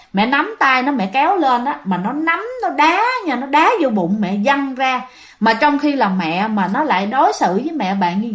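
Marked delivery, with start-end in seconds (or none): none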